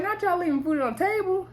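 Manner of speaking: high, mock-scandalized question; incredulous. Real, not acted. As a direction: playfully call them out in disbelief. Mock outrage at wasted food.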